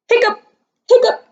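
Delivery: squeaky high hiccup sound; a sudden high-pitched hiccup. Acted.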